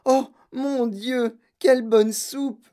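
slow, high-pitched swoon of pleasure; rapturous delight. Acted. Girl relishing soup from gum, slow rapture. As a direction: savor it slowly in a high voice.

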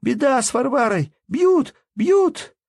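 very high, loud alarmed scream; breathless girl's shout. Acted. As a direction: yell for help while out of breath. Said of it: "прокричала задыхающимся голосом".

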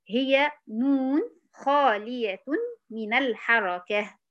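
drawn out word by word, leaning on نون; slow dictation. Shown as a demonstration. Teacher dictating the tajweed definition slowly. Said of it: "dictating tajweed definition".

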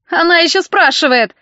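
high indignant cry; shouting. A narrator's reading. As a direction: shout it in outrage. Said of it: "закричал он".

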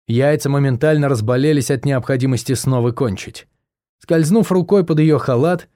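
ordinary delivery

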